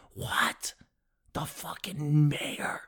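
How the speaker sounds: hushed, slow whisper of disbelief; whispered aside. Pretend. Comic whispering in mock awe that the mayor showed up. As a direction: whisper it like you can't believe it.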